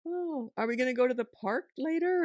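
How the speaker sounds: breezy chat to oneself, as if in total control; lightly musing aloud, paying the fuss no attention; unbothered self-talk. Shown as a demonstration. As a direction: carry on your own cheerful narrative. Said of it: "I just talk as if I'm in total control".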